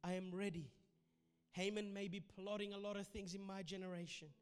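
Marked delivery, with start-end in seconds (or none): 0.0-0.7 hushed worship prayer; soft prayer. Acted. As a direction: pray it gently under the music.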